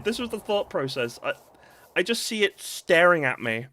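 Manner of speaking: usual speaking voice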